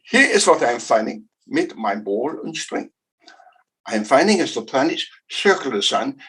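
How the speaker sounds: put-on a light comic German accent. Lecturer impersonates Kepler explaining elliptical orbits, light German accent. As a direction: play Kepler as a comic German with ball and string. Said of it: "he spoke like this".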